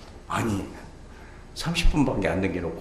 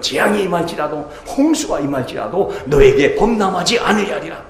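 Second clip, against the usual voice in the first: scripture proclamation; 6 semitones higher, 7 dB louder than usual. Acted.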